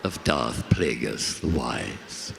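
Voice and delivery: low, slow, sinister emperor; weighty commanding emperor; Emperor's voice. Acted. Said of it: "That's not in the Emperor's voice, is it?".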